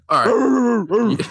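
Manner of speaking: bursting into high laughter; laughing. Real, not acted. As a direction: crack up laughing at the dog. Laughing at snoring dog.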